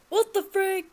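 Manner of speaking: high loud shocked shout. Real, not acted. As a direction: gasp then shout in shock. Shocked by game event.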